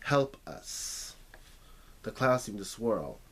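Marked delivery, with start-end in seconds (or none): 0.1-1.1 caterpillar's voice. A narrator's reading. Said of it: "She could almost hear his voice again".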